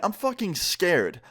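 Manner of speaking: ordinary delivery, nothing marked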